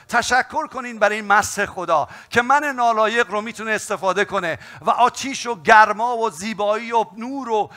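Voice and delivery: high, loud, impassioned altar call; fiery preaching. Acted. Preacher urging the church to pray, fiery and loud. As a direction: preach it at full force to the congregation.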